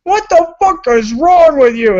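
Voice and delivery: imitating his girlfriend yelling from upstairs; a light retold yell, not full force; half-hearted impression of an angry shout; mild mock yell. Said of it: "My lady's screaming upstairs".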